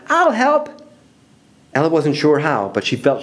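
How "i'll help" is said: very high little volunteer; high, eager small voice; piping up. Acted. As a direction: pipe up suddenly in a high voice. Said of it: "Ella piped up".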